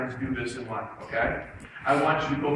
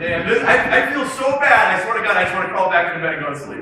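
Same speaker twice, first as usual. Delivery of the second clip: loud, high, upbeat cheer; big smiles; 3 semitones higher, 8 dB louder, faster than usual. Shown as a demonstration. Coach has students repeat gloomy words, grinning and elated. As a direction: belt it out joyfully, against the words. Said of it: "big smiles on your face".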